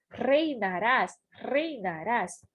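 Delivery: "Reinarás" repeated slowly with a trilled opening R; slow Spanish R. Shown as a demonstration. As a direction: stretch out "Reinarás" and roll the first R. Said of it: "prestar atenção na pronúncia do R". ("Reinarás" lento, com R inicial vibrante)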